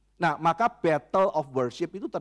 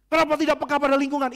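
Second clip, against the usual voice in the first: high, loud, fast indignant demand; mock accusing question; 9 semitones higher, 5 dB louder, faster than usual. A critic blaming the church for not being aware, fast and loud. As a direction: fire the accusation quickly.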